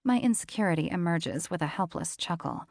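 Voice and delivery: ordinary talk, nothing marked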